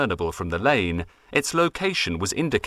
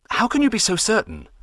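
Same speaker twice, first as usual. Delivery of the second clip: higher, curious reporter; 12 semitones higher than usual. Acted. Reporter questioning the dowser, high and curious. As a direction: ask it like an eager local reporter.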